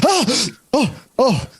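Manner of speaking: high startled yelp; startled cry. Acted. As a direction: jump in fright with a sharp cry. Boy startled by grab.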